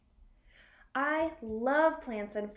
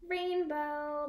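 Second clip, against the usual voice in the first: ordinary delivery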